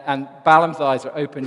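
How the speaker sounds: normal talking voice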